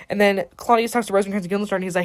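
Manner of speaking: ordinary talk, nothing marked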